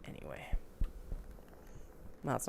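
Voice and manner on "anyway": whispering. Retold or imitated. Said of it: "to be like".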